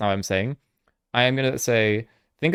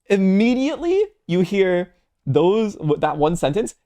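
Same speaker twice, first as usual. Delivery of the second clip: 1 imitating Soju; 6 semitones higher, slower than usual. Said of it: "how he says stuff".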